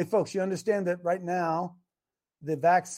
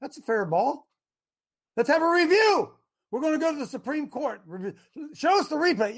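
mock umpire call; 6 semitones higher than usual. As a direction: ham up the crowd insisting on a fair call. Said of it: "And they're telling us".

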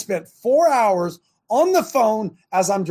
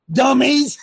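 mock shout; 8 semitones higher, 7 dB louder than usual. Mocking listeners who use credit.

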